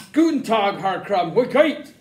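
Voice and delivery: loud German greeting; German accent. Acted. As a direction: bark it in German. German soldier greets Herr Krumm.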